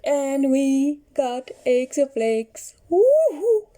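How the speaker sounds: loud, high joyful woohoo; excited cheer. Real, not acted. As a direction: burst out cheering with delight. Eggs found, cheering.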